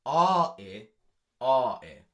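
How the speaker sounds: loud, sung-out "ah" and "eh"; vowel demo. Shown as a demonstration. Teacher sounding out the stressed vowels loudly. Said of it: "stressing the same vowel sounds".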